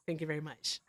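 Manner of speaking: usual speaking voice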